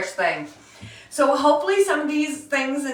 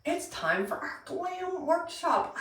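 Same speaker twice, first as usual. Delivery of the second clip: high, slow mock announcer voice; alarm voice; 7 semitones higher, 8 dB quieter, slower than usual. Playful host mimicking her alarm, high and slow. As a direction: sing it out like an alarm going off.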